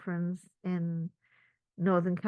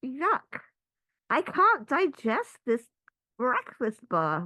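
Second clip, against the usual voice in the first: body's complaint; 4 semitones higher, 3 dB louder, slower than usual. Pretend. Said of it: "the body says".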